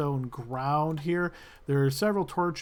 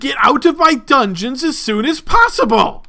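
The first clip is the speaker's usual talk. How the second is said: loud, much higher shouting order; furious guard; 9 semitones higher, 15 dB louder than usual. Acted. Guard ordering the party out of his dungeon, shouting. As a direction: throw them out at full volume. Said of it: "very sneering look".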